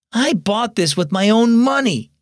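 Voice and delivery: put-on a touch of a cross young girl; angrily. A narrator's reading. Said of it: "she said angrily".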